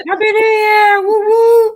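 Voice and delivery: very high, loud party cheer; whooping celebration. Real, not acted. Guest joining the New Year greeting, whooping cheer. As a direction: whoop it up at full pitch.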